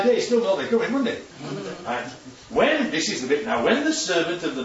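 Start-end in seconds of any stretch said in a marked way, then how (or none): none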